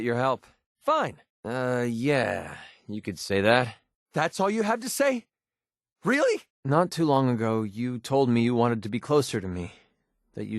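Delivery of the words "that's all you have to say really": high, rising incredulous questions; indignant disbelief. Acted. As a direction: pitch leaps up, can't believe it. Acted reaction to a letdown.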